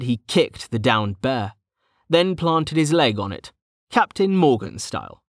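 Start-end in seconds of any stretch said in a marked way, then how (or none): none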